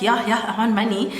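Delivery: ordinary talk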